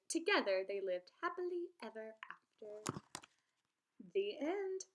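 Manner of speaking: lively read-aloud storytelling voice; story read aloud. A narrator's reading. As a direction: read the tale to young children, bright and clear. Teacher reads story aloud.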